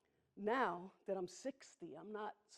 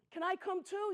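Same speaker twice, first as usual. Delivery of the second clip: much higher, louder pleading question; the pig begs to come along, pitched up; pig's voice; 11 semitones higher, 7 dB louder than usual. Retold or imitated. Pig asks to join.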